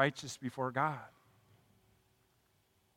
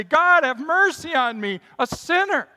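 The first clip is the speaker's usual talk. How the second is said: crying out; 12 semitones higher, 11 dB louder than usual. Acted. Believer cries out to God, loud and high. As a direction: cry God, have mercy, at full force.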